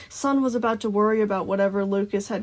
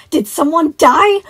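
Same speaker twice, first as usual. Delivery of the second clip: put-on loud, high shocked child; gasped; 8 semitones higher, 14 dB louder than usual. A narrator's reading. Boy asking Sun about a death, shocked gasp. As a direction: gasp it out slowly, wide open. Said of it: "Tyler gasped".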